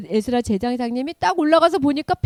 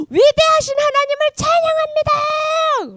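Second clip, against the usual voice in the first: exultant praise; 4 dB louder than usual. Acted. People moved by sweet words praising God, exultant shout. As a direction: cry it out in worship.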